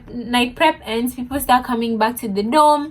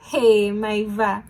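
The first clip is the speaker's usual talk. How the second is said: ordinary delivery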